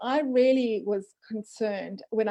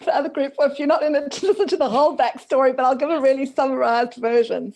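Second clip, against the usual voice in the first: talking through a laugh, louder and higher; 4 semitones higher, 8 dB louder than usual. Real, not acted. Guest laughing to host while recapping backstory, laughing. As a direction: laugh the words out.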